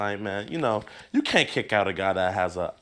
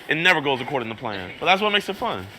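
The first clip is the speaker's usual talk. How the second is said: normal talking voice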